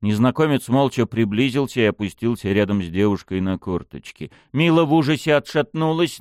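ordinary delivery

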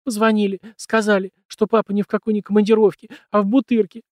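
high, loud tearful wail; crying on the phone. A narrator's reading. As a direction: wail it through sobs. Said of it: "Она зарыдала".

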